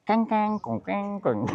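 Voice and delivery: 模仿泰国口音; mock Thai accent. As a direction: imitate a Thai monk mangling the sounds. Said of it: "你叫一个泰国师傅来念".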